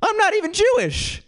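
very high-pitched, exasperated mock outburst; pissed-off friend. Friend Jeremy protests being yelled at, mock pissed off. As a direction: squeal it in comic outrage. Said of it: "Jeremy's pissed off".

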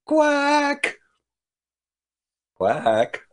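high, sultry duck quack; seductress duck call. Acted. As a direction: lure them in with a flirty quack. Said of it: "sounds like a seductress duck".

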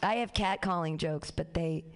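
usual speaking voice

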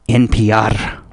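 ordinary delivery

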